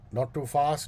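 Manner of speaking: ordinary talk, nothing marked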